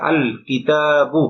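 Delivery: আল কিতাবু drawn out slowly, the lam of আল clearly sounded; deliberate teacher demo of আল কিতাবু with a distinct lam; slow pronunciation demo. Shown as a demonstration. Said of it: "লাম উচ্চারণ হয়েছে".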